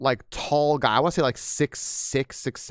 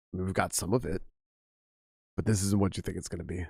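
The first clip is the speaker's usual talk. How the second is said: lower, quieter deep-voiced lecturer; deep voice impression; 5 semitones lower, 3 dB quieter than usual. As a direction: imitate a towering serious teacher booming welcome. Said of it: "he does, like, really deep voice".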